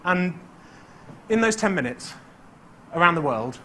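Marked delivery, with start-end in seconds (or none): none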